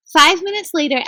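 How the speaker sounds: plain reading voice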